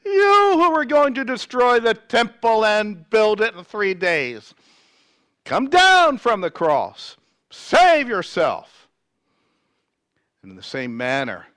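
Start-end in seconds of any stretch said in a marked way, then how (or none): 0.1-8.9 loud, high jeering shout; hurled insults. A narrator's reading. Passers-by hurl insults at Jesus on the cross, jeering shout. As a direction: jeer it out at full voice, far higher than usual. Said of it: "hurled insults at him".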